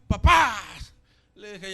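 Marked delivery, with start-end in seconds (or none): 0.1-0.6 loud, sudden shout at dad; Aufschrei zum Vater. Retold or imitated. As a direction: cry out to stop your father. Als Kind den Vater anschreien.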